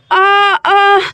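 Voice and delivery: loud strained groans of labor pain; pained moans. Acted.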